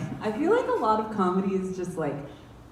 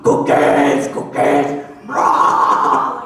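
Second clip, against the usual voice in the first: cookie monster growl; 6 semitones lower, 11 dB louder, much slower than usual. Retold or imitated. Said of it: "I was like".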